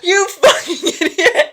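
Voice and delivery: high-pitched exasperated insult; hammed-up indignation; mock outrage. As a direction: imitate a man losing his temper. Acted outraged owner insulting robot.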